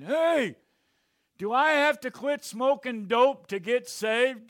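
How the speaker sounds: high, loud yell from the crowd; stoned heckler yelling. Retold or imitated. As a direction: shout it up at the stage. Stoned heckler yells at comedian.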